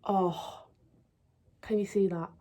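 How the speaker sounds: ordinary delivery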